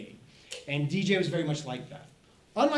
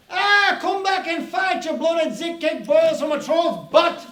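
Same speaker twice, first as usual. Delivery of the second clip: slow, high, roaring challenge at full volume; battle yell; 11 semitones higher, 9 dB louder, slower than usual. Acted. Cat chasing giant hippos, high furious mock yell. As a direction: holler it out like a fierce little fighter. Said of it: "yells to the hippos".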